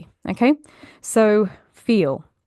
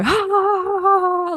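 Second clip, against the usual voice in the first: high, loud forced laugh; one long fake laugh held up high; forced laughter; 12 semitones higher, 3 dB louder than usual. Shown as a demonstration. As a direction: laugh right in their face, deliberately forced. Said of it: "we're literally forcing laughs".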